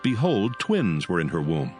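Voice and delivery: usual speaking voice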